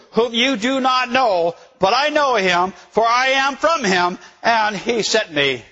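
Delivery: slow, high, insistent proclamation; angry, frustrated outcry. Acted. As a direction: cry it out word by word, irritated. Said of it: "angry, frustrated, irritated".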